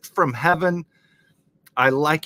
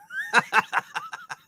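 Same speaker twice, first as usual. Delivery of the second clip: burst of laughter. Real, not acted. Laughs at the lyrics.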